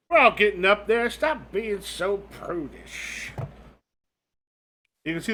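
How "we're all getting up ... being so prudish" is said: put-on scolding old lady, drawn-out ending; old woman ghost. Old woman scolding her friend, high then drawn out. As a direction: chide her, stretching out the last words. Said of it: "you got these two old women".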